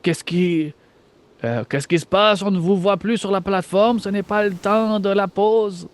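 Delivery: French in a mock German accent over the radio. Guard radioing the missing men on the platform, German accent. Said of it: "d'un accent allemand".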